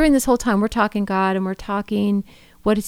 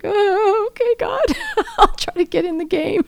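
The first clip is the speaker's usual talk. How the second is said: much higher, giggly, sheepish self-imitation; sheepish reply to God; 11 semitones higher than usual. Wife mimics her own meek answer to God, high and giggling. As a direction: squeak out a meek yes to God, laughing. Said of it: "I'm just like".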